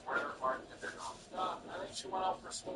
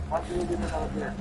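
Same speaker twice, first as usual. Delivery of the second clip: ordinary talk, nothing marked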